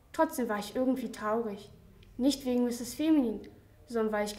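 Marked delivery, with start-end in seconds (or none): none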